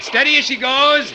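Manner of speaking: high, loud called command; shouted order. Acted. Captain calls to the helmsman, shouted command. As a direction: bark the order to the helm.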